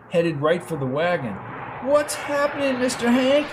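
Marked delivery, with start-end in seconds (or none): 1.9-3.5 put-on high, scared young boy's voice; a boy asking in fear, much higher; frightened boy. A narrator's reading. Boy to Hank as the ships come down, scared high child voice. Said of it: "asked the boy as they lay".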